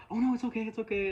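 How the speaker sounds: high, loud mock reassurance, words falling; reassuring musician. Musician calming crying fan, high hammed-up reassurance. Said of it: "And he was like".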